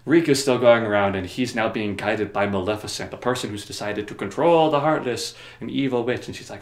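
ordinary delivery

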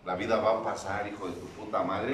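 normal talking voice

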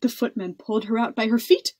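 usual speaking voice

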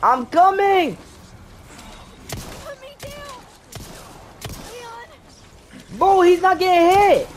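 panicked yelling. Real, not acted. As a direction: yell in real panic at the game. Panicked yelling during game chase.

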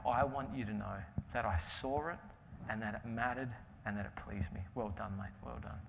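soft, low, reassuring voice; God's tender approval. Retold or imitated. God praising the young butcher after his award, soft and tender. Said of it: "I felt God say to me".

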